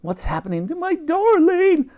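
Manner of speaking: very high fretful lady's call; high anxious old lady voice; Dottie calling. A narrator's reading. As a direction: call out from the ship in worry. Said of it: "called out Dottie".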